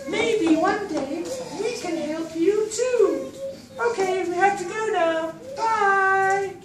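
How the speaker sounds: very high, squeaky thankful fish voice; grateful little fish. Acted.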